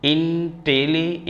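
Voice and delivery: teacher drawing out Intelli- slowly to show its syllables. Shown as a demonstration. Teacher breaks the word apart for students, slowly. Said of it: "इसका pronunciation करेंगे".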